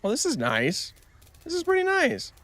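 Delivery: bursting with delight; excited squeal. Real, not acted. Delighted by new game creature.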